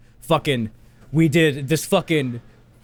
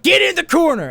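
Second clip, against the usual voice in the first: hammed-up furious order; teacher sends the child to the corner; mock stern teacher; 12 semitones higher, 3 dB louder than usual.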